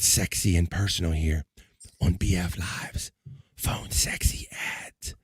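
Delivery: slow breathy announcer whisper; sultry whisper. Acted. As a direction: purr the segment title slowly. Announces the segment seductively.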